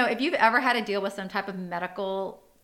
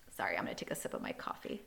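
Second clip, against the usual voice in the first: whispered aside; 12 semitones lower, 10 dB quieter, faster than usual. Real, not acted. Aside before sipping coffee.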